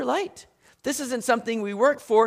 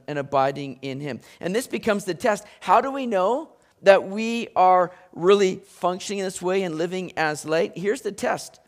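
ordinary talk, nothing marked

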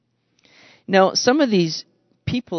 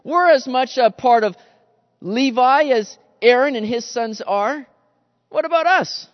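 rising, louder cry of unfairness; indignant complaint; 5 semitones higher, 5 dB louder than usual. Retold or imitated. Levites protesting to God about unfair treatment, indignant. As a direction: protest that it isn't fair, pitching up. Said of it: "easy for the people of Levi to begin to think".

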